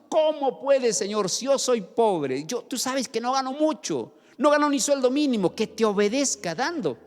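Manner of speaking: queja aguda y actuada; protéstale a Dios con tono agudo de excusa; protesta indignada. Acted. Acted objection to God's command. (reclamo agudo de quien pone excusas)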